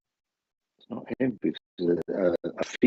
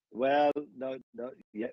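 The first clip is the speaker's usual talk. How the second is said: usual speaking voice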